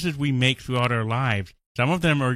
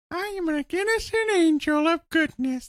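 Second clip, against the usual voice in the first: much higher, innocent angel voice; sweet angel voice. Acted. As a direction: squeak it out like a sweet little angel. Mocking good-choice character.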